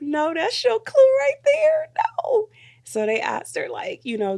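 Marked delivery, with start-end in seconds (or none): 0.0-2.5 high, loud, slow, half-crying laugh; laughing protest. Retold or imitated. Warning the bride at the screen, high laughing protest. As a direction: beg through laughter. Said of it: "I was like".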